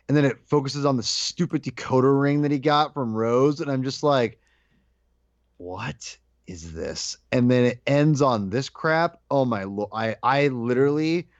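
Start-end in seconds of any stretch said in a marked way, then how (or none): 5.6-7.2 slow, low, soft disbelief; quietly appalled, word by word; hushed disbelief. Retold or imitated. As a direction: draw it out quietly, stunned. Baffled reaction to the film's ending.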